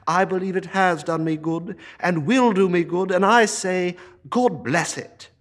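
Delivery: high, fervent heartfelt plea; earnest nephew. Acted. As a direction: speak up for Christmas with passion.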